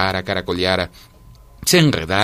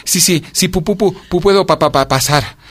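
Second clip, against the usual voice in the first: high, stuttered plea; frozen visitor begging entry, pitched up; shivering stammer; 9 semitones higher, 3 dB louder than usual. A narrator's reading. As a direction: trip over each syllable from the cold. Cicada stammers asking to enter.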